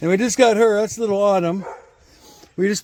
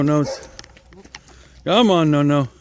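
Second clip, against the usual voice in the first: usual speaking voice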